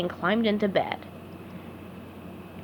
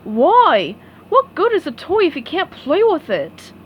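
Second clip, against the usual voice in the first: Patrick voice; 6 semitones higher, 5 dB louder than usual. A narrator's reading. Patrick questioning.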